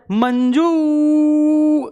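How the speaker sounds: long stretched call of the name, pitched high and loud; drawn-out call. Shown as a demonstration. Teacher calling a student by name, a long drawn-out high call. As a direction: call out to Manju, holding the final vowel. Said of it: "तो हमने जू नहीं बोला".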